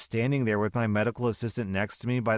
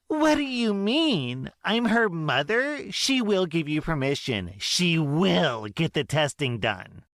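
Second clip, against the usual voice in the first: much higher, louder, slow indignant mother; outraged mother; 8 semitones higher, 3 dB louder than usual. Acted. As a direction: demand it, offended Karen.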